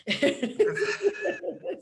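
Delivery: high squealing laugh at her own punchline; burst of laughter. Real, not acted.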